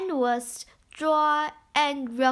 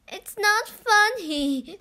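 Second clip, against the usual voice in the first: put-on higher, cross girl voice; a scared girl snapping back, pitched up; Beth upset; 6 semitones higher, 4 dB louder than usual. A narrator's reading. Beth to laughing Home, higher cross girl voice. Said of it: "said Beth".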